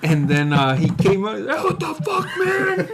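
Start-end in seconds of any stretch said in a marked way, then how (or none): 1.5-2.9 very high-pitched mock wail through laughter; mock outcry. Imitating friend who just got punched, high laughing mock wail. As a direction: squeal it, imitating a stunned victim. Retold reaction after being punched.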